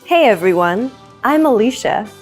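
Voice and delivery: usual speaking voice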